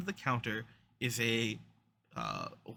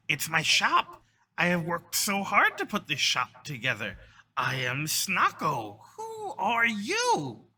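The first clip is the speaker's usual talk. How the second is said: high kobold voice, slowing for the introduction; proud kobold shopkeeper; 6 semitones higher, 4 dB louder than usual. Acted. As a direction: announce yourself proudly as a little creature. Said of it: "they start speaking in Draconic".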